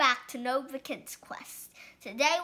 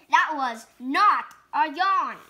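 louder, stress on not; loud denial; 7 dB louder than usual. Acted. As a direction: snap back defensively. Pigeon denies yawning.

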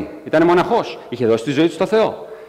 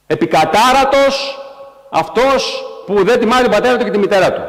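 1 proclaimed curse; 5 semitones higher than usual. Acted. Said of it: "φωνάζουν και θα λένε".

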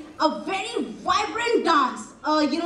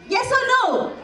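calling out; 5 semitones higher than usual. Real, not acted. Host calling to audience.

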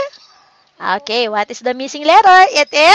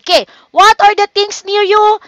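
ordinary talk, nothing marked

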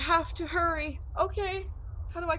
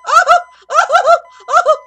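yelling yes at the top of his lungs, laughing; real relief exploding into shouts and laughter; triumphant screaming; 14 semitones higher, 19 dB louder, slower than usual. Real, not acted. Surviving the night at last.

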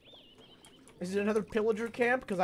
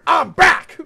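mock rebel shout; 6 semitones higher, 14 dB louder than usual. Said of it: "I heard a rebel go".

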